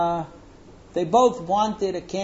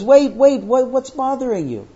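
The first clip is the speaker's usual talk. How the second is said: raised, urgent fatherly concern; acted alarmed father; 7 semitones higher, 7 dB louder than usual. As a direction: stop her anxiously, pitch up. Father alarmed as daughter bawls.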